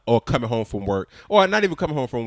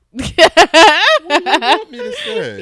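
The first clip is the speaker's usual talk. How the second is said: high, loud, drawn-out helpless dad voice; mocking the dad; 10 dB louder, much slower than usual. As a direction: play the cornered father, stretching it out. Husband voices her dad in story.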